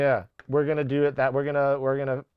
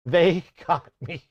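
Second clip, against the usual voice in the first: laughing. Real, not acted. Said through laughter.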